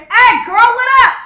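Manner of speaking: high, screamed greeting across a room; hollering. Acted. Greeting a girl at church, hollering and screaming. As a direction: holler it out at full volume. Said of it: "you're not gonna see me hollering and screaming".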